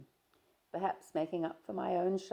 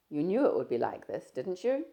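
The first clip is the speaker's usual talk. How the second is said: a louder, lightly voiced young man, breezy; Stephen, teasing; 7 dB louder than usual. Stephen greeting his shy friend, easy and teasing. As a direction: play Stephen chatting easily, a touch louder.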